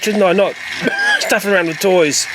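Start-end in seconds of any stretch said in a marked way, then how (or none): 0.6-1.1 a short sharp cough; real cough. Real, not acted. Sick reviewer coughs between his words. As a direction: cut off by one quick cough. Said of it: "I can't talk".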